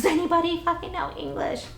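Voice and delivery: high, frantic yell for help; panicked high-pitched scream for anyone speaking English; retold scream. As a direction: shout it to the whole train car, desperate. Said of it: "screaming at the top of my lungs".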